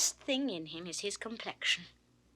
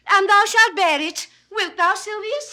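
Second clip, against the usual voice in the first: commanding; 9 semitones higher, 12 dB louder than usual. Acted. Phoebe commanding Silvius.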